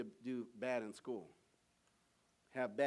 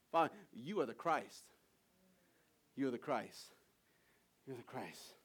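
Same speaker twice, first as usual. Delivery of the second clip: hushed realization. Retold or imitated. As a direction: let it sink in. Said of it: "Simon was like".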